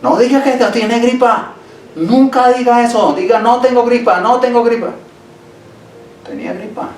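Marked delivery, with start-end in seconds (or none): 0.0-4.9 imitating a pushy brother. Pastor mimics the church brother who scolded him, high and insistent. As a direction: mimic the brother insisting you deny the flu. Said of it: "un hermano me dijo".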